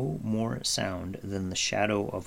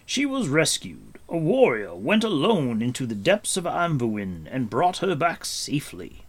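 raised, proud telling; Gwydion; 5 semitones higher, 4 dB louder than usual. Acted. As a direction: recount a heroic deed with emphasis. Gwydion tells of the rescue.

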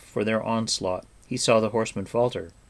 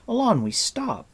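sharp, higher cry to stop her; cried out; 12 semitones higher than usual. Acted. Taran shouts to stop Eilonwy running off, sharp cry. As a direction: call her back before she runs off. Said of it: "Taran cried".

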